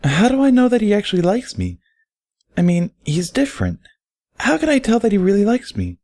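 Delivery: put-on high, far-off girlish pony voice, anxious; Derpy's voice. Derpy asking Barry in the bath, high and anxious.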